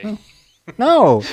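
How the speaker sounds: high, loud denial; emphatic protest. Real, not acted. Friend denying a teasing racism charge, loud protest. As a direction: cry out in objection.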